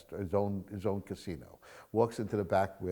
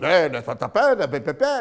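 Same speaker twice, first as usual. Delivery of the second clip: higher, louder nonsense chatter; excited crowd babble; 6 semitones higher, 10 dB louder than usual. Retold or imitated. Gamblers all shout their own numbers, excited babble. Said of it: "everybody else goes".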